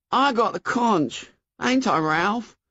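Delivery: put-on high boy's voice appealing for support; pleading Piggy. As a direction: look to the leader for backing.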